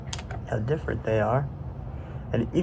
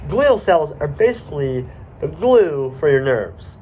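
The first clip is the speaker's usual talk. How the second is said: ordinary delivery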